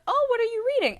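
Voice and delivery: sweet nosy teacher. Retold or imitated. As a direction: play the prying teacher, all fake sweetness. Teacher walks up, imitated.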